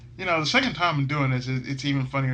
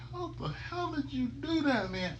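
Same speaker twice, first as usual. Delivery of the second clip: 1 astonished Phoenix; 13 semitones higher, 6 dB quieter than usual. Acted. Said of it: "it's like".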